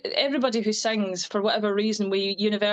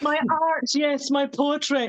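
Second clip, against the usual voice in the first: higher, drawn-out airs of a poet; mock pretentious; 4 semitones higher, slower than usual. Singer mocking their own pretentious talk of art, mock pretentious. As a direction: send up your own artiness, stretching the words. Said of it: "pretentious when you just say it".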